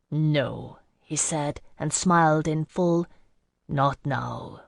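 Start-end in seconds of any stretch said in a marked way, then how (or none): 0.1-0.8 lightly voiced man, low; low, understated male reply; man's voice. A narrator's reading. Jocelyn's tender reply to narrator. 3.7-4.6 low, soft male reply; hushed, deeper character voice; man's voice. A narrator's reading. Jocelyn's tender reply, smiling.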